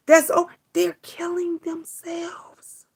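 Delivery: slow, breathless whisper; hushed, hurting whisper. Real, not acted. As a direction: let it sink to a pained whisper. Hushed aside about suicides.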